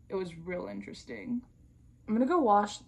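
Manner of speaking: ordinary delivery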